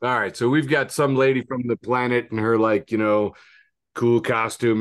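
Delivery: usual speaking voice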